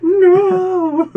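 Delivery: very high, loud anguished wail; desperate cry. Pretend. Tom Hanks calling after Wilson swimming away, desperate wail. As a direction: scream after the drifting ball. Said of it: "He's like".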